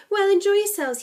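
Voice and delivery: high, loud send-off; jovial old Scotsman. A narrator's reading. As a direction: call a cheery farewell. Old man chuckles, sends them off.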